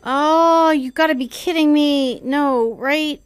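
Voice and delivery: loud, high alarmed disbelief; alarmed outburst. Real, not acted. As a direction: react in shocked alarm. Incoming enemy alarm.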